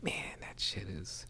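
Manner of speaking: hushed awed whisper. Retold or imitated. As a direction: whisper it like a secret. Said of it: "I'm just all like".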